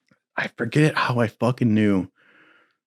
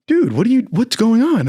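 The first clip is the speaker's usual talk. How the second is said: startled recruiter; 11 semitones higher, 4 dB louder than usual. Retold or imitated. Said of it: "He goes".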